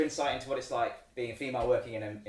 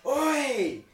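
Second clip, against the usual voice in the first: high, loud mock football-hooligan shout; 13 semitones higher, 8 dB louder, much slower than usual. Imitating drunk hooligans heckling her in the beer tent, mock shout. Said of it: "They were, like, shouting stuff".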